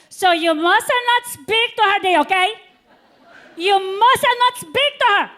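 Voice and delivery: high, loud, slow insisting; mock cleaner's instruction. [name] instructing Dee about Monica, high and slow. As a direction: spell it out slowly, insistent.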